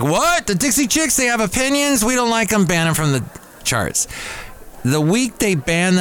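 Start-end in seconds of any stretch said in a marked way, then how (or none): 0.0-4.1 high-pitched indignant fans; squeaky-high parody of offended fans; mock outrage. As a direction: act out scandalized listeners, pitched way up.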